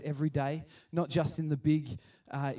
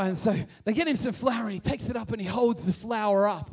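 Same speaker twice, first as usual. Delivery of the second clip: ordinary delivery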